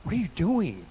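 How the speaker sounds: hushed plea. Retold or imitated. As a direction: ask it quietly like a troubled psalmist. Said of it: "David asked that in the Bible".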